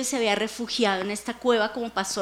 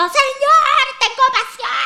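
much higher, louder cry; shouting to God; 13 semitones higher, 7 dB louder, slower than usual. Shown as a demonstration. Preacher demonstrates crying out to God, loud.

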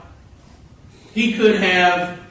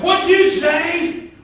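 indignant challenge; 13 semitones higher, 4 dB louder, slower than usual. Retold or imitated.

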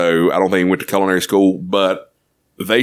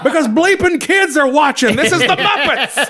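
high-pitched exasperated mock yell; Gordon Ramsay. Chef explains the bleeping to Sweetums, exasperated mock yell. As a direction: snap back as an irate chef. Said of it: "Gordon Ramsay is yelling at Sweetums".

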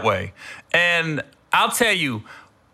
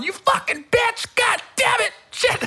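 retold furious yelling. Fan yelling at the TV in his hotel room, furious cursing. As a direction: scream curses like the game just ruined you. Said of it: "you know I'm yelling".

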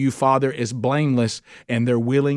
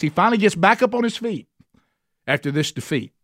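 higher, pressing sermon voice; fiery preaching; 4 semitones higher than usual. Acted. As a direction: drive it home to the congregation. Preaching at full force.

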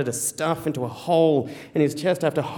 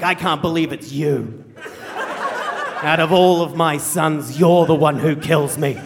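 retold tirade; slower than usual. Wounded dad disowning his child, slow acted disgust. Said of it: "Dad continues this tirade of abuse".

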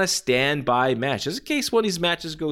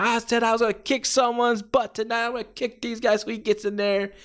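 ranting Terry Funk; 10 semitones higher than usual. Retold or imitated. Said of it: "Terry Funk's like".

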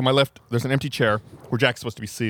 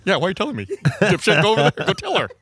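cracking up mid-quote, much higher; telling off the guest with a laugh; laughing retort; 8 semitones higher, 3 dB louder than usual. Retold or imitated. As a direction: blurt it out through laughter. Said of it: "it was just like".

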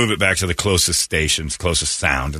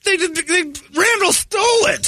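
mock outrage. Real, not acted. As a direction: shout the accusation high. Outrage at the stolen idea.

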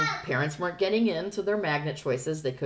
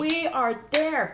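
high, drawn-out retold rallying cry; hammed-up school spirit; gung-ho commitment; 9 semitones higher, much slower than usual. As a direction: declare it slowly like a keen booster.

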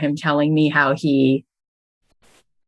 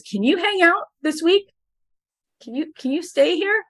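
eager director; 12 semitones higher than usual. Retold or imitated. Director eagerly asks him to stay.

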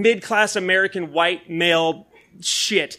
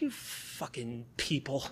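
resentful mutter; 5 semitones lower, 17 dB quieter, slower than usual. Retold or imitated. Resentful guy muttering at happy people.

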